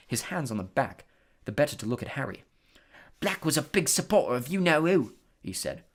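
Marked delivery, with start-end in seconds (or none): 3.2-5.1 chatty conductor. Acted. Stan lowers to tell secret.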